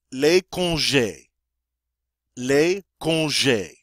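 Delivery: slow, careful "Les congés" with the stress on "les"; French model pronunciation. Shown as a demonstration. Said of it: "And that is how you say".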